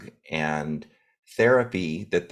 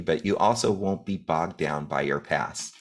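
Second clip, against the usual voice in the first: ordinary talk, nothing marked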